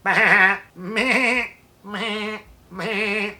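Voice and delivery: bleating like a goat; playful goat bleats. Retold or imitated. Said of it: "making goat sounds like".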